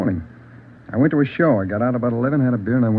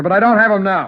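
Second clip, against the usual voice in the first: angry; 8 semitones higher, 5 dB louder than usual. Acted. Suspect shouting denial at detectives.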